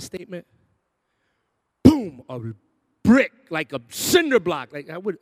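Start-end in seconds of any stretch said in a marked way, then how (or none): none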